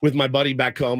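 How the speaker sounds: usual speaking voice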